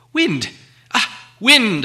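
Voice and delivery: high, loud blurt of relief; relieved outburst. Acted. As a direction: shout the answer in relief. Bilbo blurts the answer.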